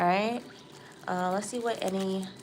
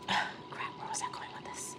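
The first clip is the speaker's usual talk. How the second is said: unvoiced whisper to herself; hushed, asking herself; whispered self-talk; 8 dB quieter than usual. Real, not acted. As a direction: whisper it while searching for the lost thought. Muttering after losing her thought.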